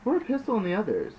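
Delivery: high, loud, fast order. Acted. Trash orders the others to cover the bodies, fast and loud. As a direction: snap the order out quick. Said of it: "Trash orders".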